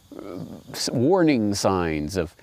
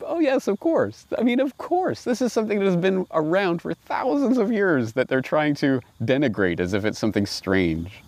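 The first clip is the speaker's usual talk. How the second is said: high-pitched mock realization, as if amazed; sarcastic high-pitched aha; mock eureka; 8 semitones higher than usual. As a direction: swing the voice way up in sarcastic wonder.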